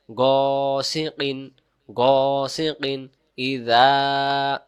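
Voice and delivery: গাসিকিন drawn out, one alif on গ; slow careful recitation. Shown as a demonstration. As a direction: stretch গ one alif slowly. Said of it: "এর চিহ্ন এক আলিফ".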